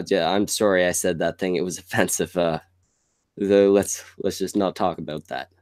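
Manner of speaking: usual speaking voice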